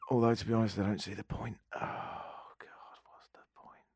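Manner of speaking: grim, hopeless announcer. Acted. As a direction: despair sinking into a whisper. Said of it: "The grimmest announcement".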